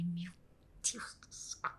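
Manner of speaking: breathy whispered "Deus do céu" under her breath; aghast mother whispering at son's insult; shocked whisper. Real, not acted. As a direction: whisper it in disbelief, almost voiceless. Shocked aside while retelling.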